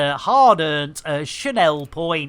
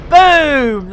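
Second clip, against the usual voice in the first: shout; 7 semitones higher, 7 dB louder than usual. Real, not acted. Triumphant shout.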